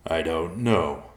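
slow, grudging answer; hesitant bear, drawn-out reply; reluctant. A narrator's reading. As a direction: admit it unwillingly, drawing it out.

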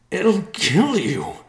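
high, loud, drawn-out cry. A narrator's reading. Riverpaw begs his father not to go, crying out. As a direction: plead at the top of your voice. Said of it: "Riverpaw cried".

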